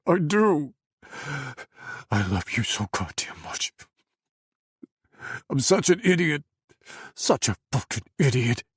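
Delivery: loud, broken confession; voice falling apart. Acted. Adam confessing love to Faisal, voice breaking. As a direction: pour it out, cracking. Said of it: "his voice falling apart as he gasped".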